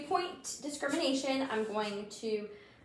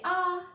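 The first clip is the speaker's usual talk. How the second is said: open-mouthed ahh, a bit higher and louder; saying ahh; 7 semitones higher, 5 dB louder than usual. Shown as a demonstration. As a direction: model a long ahh for the patient. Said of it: "And can you say".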